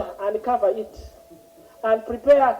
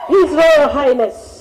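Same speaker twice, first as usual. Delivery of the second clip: slow, loud royal address; booming royal announcement; 4 dB louder, much slower than usual. Pretend. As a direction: proclaim him like royalty. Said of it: "In my home".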